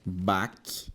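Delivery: Bac as one lone low syllable, then a pause. Shown as a demonstration. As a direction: sound out Bac by itself, closed on the c. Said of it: "como é que seria a separação silábica".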